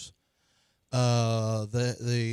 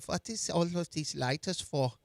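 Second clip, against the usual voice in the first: slowish, softer German officer; German accent; 2 semitones higher, 6 dB quieter than usual. Retold or imitated. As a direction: question the lighters in a German accent. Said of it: "Don't do your German accent anymore".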